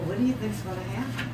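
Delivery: ordinary delivery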